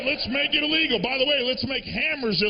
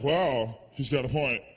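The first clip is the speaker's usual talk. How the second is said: put-on dazed stoner girl; 9 semitones lower than usual. Stoned girl agrees with her dog, dazed and low. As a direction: play it blank and mindlessly convinced. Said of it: "she goes like this".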